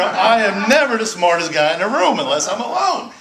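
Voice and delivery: real laughing shout at his own joke; loud laughing. Real, not acted. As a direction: laugh and shout the self-mockery. Laughing confession to the audience.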